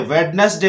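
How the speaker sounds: वेडनेसडे in three syllables, d sounded; spelling-reading of वेडनेसडे with audible d; wrong pronunciation. Shown as a demonstration. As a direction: say वेडनेसडे as wed-nes-day. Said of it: "जो कि बिल्कुल गलत है".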